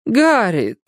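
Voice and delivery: high hammy cry of her name; theatrical exclamation. A narrator's reading. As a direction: call out like on stage. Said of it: "театрально воскликнула Аделаида". (театральное восклицание)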